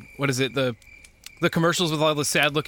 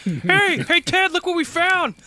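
much higher, gleeful shout-out; high excited call; slower than usual. Pretend. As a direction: call out like a proud dumb kid. Acted mountain man calling friend.

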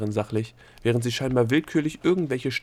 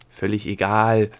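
dismissive; 8 dB louder, slower than usual. A narrator's reading. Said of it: "winkte der Gnom ab".

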